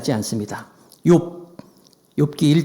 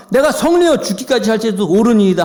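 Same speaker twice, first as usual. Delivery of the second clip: stubborn anger; 5 semitones higher, 4 dB louder than usual. Acted. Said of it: "계속 화내는 거야".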